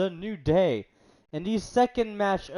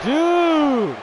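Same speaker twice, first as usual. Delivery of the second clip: frustrated shout; 9 semitones higher, 9 dB louder than usual. Real, not acted. Gamer frustrated at game.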